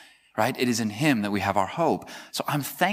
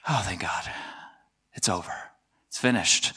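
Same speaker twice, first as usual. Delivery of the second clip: soft, low and weary relief; relieved sigh; 6 semitones lower, 6 dB quieter than usual. Acted. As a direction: let it out quietly, relieved the week is over. End-of-week relief acted out.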